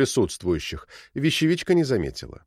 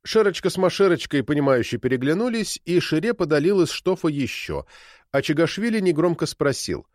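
ordinary delivery

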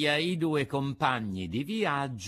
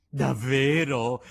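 loud, drawn-out, delighted question; overjoyed cry; 7 dB louder, slower than usual. A narrator's reading. Puppet hears father is coming, overjoyed cry. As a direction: cry out slowly in joyful disbelief. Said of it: "gridò Pinocchio saltando dall'allegrezza".

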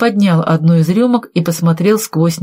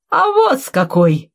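defiant, higher-pitched retort; с вызовом; 8 semitones higher than usual. Acted. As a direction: throw it back triumphantly. Defiant answer showing the will. (с вызовом, торжествующе)

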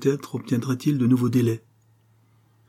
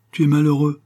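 raised, louder cry of distress; distressed, only lightly tearful; sobbing, understated; 6 semitones higher, 6 dB louder than usual. A narrator's reading. Diane answering her father through tears, distressed. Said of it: "répondit Diane en sanglotant".

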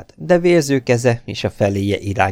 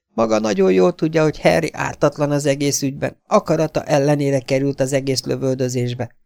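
high-pitched, heated defence; indignant protest; 8 semitones higher, 3 dB louder than usual. A narrator's reading. Girl defends her brother to a blackmailer, indignant. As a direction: protest the injustice in a raised pitch.

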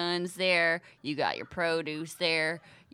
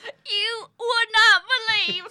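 dramatic wail; 10 dB louder than usual. Acted. Trucker boasts of her lover.

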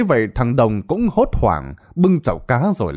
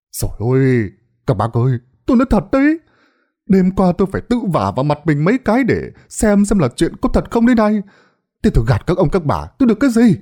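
indignant protest; 7 semitones higher, faster than usual. Acted. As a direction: protest hurt innocence, voice jumping up. Đồng protests his honesty. (giọng phân trần, cao vút)

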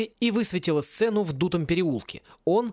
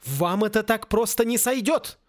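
higher, protesting voice; indignant; 5 semitones higher than usual. A narrator's reading. Wizard protesting to the villain, indignant. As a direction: object with rising indignation. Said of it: "возмутился Луж".

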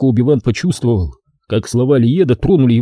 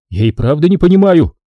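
mild angry outcry, higher; angry exclamation; 6 semitones higher, 3 dB louder than usual. Acted. Brother exclaiming angrily at Liet. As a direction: fling it back at him. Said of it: "гневно воскликнул Тараон".

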